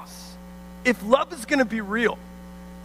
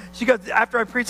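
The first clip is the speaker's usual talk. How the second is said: usual speaking voice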